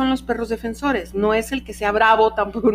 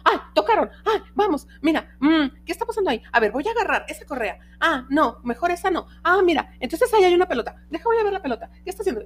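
curious tester dog; 7 semitones higher, faster than usual. Retold or imitated. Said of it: "que va de".

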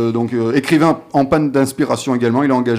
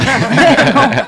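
high laugh; 12 semitones higher, 6 dB louder than usual. Real, not acted. Laughs at Brad Pitt joke.

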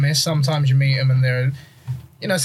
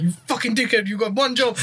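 higher pitched, scolding; mock angry insult; 9 semitones higher than usual. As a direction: imitate a furious teammate, pitched up. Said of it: "And he's like".

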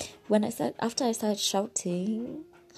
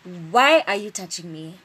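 loud indignant shout; retold shouting; 9 dB louder than usual. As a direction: shout it as a shocked challenge. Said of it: "I just started shouting".

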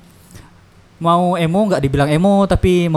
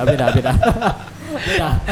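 laughing; 4 semitones higher than usual. Real, not acted. Denying while laughing.

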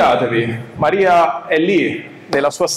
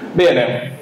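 normal talking voice

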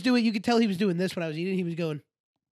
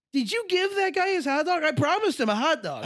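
high, urgent acted question to dad; mock panic, pitch jumping up; alarmed pleading; 9 semitones higher than usual.

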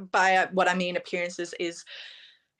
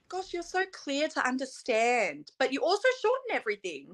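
lightly voiced admirer, higher pitch; imitating international contestants; 6 semitones higher than usual. Pageant contestant retelling others' comments on her speech, lightly imitated admirer. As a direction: quote an impressed fellow contestant, pitched up. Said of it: "everyone actually said to me".

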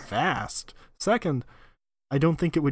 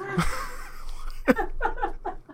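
squealing high laugh; helpless giggle fit; high giggling fit. Real, not acted. Explosion punchline sets off giggles.

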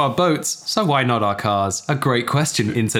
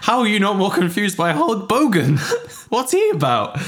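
laughing; 9 semitones higher than usual. Real, not acted. Cracking up at Hulk Bogan.

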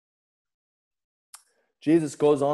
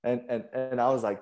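ordinary talk

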